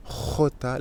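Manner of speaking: "J" as khota, from the throat; guttural Spanish jota. Shown as a demonstration. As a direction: rasp the start of "J" as in khota. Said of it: "très guttural".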